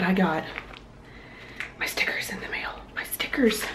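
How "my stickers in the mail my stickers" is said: excited whisper. Real, not acted. Excited whisper over mail arrival.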